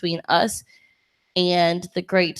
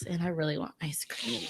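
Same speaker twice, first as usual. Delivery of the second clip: hushed, breathy aside; whisper; 2 semitones lower, 13 dB quieter than usual. Real, not acted. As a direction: whisper it as a secret. Whispered aside near mic.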